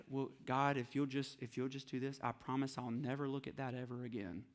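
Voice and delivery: mock bargaining prayer to God, said softly. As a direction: beg God quietly, half-ashamed.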